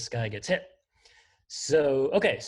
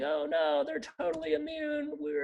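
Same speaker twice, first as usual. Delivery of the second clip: high, mock-alarmed oh no; mock dismay. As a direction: play up fake panic. Viruses don't react.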